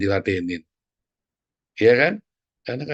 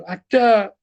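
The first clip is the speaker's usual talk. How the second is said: loud retort; 9 semitones higher, 6 dB louder than usual. Retold or imitated. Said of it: "Saya bilang".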